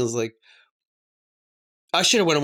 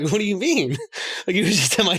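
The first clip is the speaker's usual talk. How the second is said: surprised boss; 5 semitones higher than usual. Retold or imitated. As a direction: imitate the boss's light disbelief. Boss's surprised reply retold.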